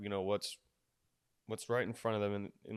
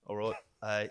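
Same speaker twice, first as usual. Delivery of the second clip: Harry Potter; 2 semitones lower, 5 dB quieter than usual. Shown as a demonstration. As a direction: feel your way into a British accent. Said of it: "a Harry Potter mode".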